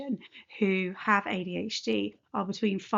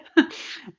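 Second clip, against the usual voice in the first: short breathy chuckle; embarrassed laugh; 6 dB louder than usual. Real, not acted. Laughs at her hiccups.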